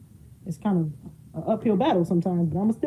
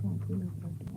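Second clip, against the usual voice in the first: mumbled quote; 2 semitones lower, 13 dB quieter, faster than usual. Retold or imitated. Son's blunt quote, voiced low.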